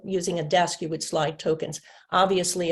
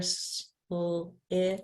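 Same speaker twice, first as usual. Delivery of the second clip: student blending; much slower than usual. Pretend. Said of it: "my student was really good".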